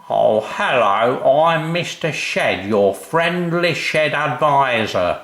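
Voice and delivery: high, slow, chirpy sales patter; cheery salesman. A narrator's reading. Mister Shed greeting the family, high and cheery. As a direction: beam at the customers like a mascot.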